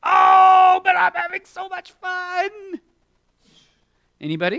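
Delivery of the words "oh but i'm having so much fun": high, slow loud whine; hammed-up child protest; whining child. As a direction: whine like a kid who won't come in. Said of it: "and you say".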